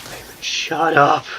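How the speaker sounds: slow, exasperated telling off; annoyed shut up. Retold or imitated. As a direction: drag it out in exasperation.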